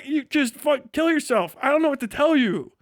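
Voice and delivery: usual speaking voice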